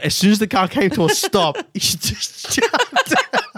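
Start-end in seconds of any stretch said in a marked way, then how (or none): 1.6-3.4 laughing. Real, not acted. Recalling friend bolting from car.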